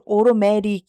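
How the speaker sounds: 오로매릭 with ㄹ in place of both T's. Shown as a demonstration. Teacher sharing a note-taking trick, 오로매릭 with ㄹ sounds. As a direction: read 오로매릭 as a Korean spelling of the flap. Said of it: "한국말로 이렇게 뭐 로 이런 식으로".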